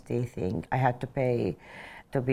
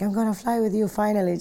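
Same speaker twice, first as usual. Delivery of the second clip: quoting the prince, much higher and brighter with eager delight; excited prince; 9 semitones higher, faster than usual. Retold or imitated. Prince tells his pilot he finally flies with her, excitedly. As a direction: pitch up high and rush it like a thrilled boss.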